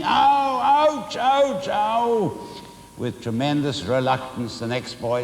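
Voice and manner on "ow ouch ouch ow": high, loud yelps, one after another; caned schoolboy yelps as he hops past; yelling in pain. A narrator's reading. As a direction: hop about crying out from the sting.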